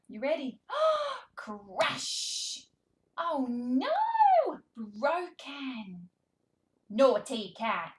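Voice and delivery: high, animated picture-book voice with big gasps; reading to a toddler. Shown as a demonstration. Speech therapist reading to toddler, animated and sing-song. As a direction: read it like a thrilling show for a baby.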